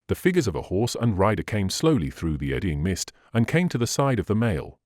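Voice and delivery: usual speaking voice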